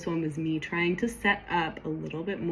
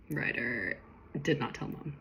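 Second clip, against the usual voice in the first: normal talking voice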